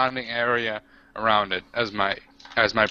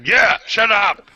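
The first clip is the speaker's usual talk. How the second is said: loud, higher, drawn-out yell; raised-voice order to shut up; in-character shout; 6 semitones higher, 6 dB louder, slower than usual. Acted. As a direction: shout them down slowly and forcefully. Player's orc silencing the others.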